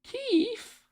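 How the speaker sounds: high, questioning call of a pet's name; calling the cat. Real, not acted. Streamer calls his cat by name. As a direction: call out gently to the pet.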